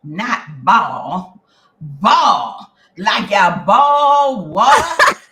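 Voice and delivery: loud, stretched Southern drawl on "ball"; Southern teacher. Retold or imitated. Southern English teacher correcting pupil, loud slow drawl. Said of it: "She looked at me".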